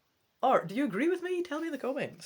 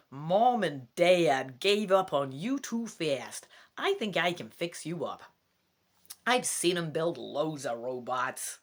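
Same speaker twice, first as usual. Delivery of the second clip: slow, louder, amateur American accent; attempted American accent; 4 dB louder, slower than usual. Shown as a demonstration. Scottish reader voicing the kid comforting the robot, slow American accent. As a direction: try on an American kid's accent as best you can. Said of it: "I'll give him an American accent".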